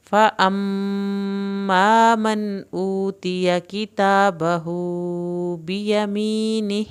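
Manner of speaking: slow chanted recitation; Quranic recitation. Shown as a demonstration. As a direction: recite the verse melodically. Full verse recited as model.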